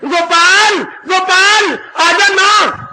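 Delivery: high calling shout of a name; mother calling her son home, loud high call. Retold or imitated. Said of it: "माँ आवाज मार रही है".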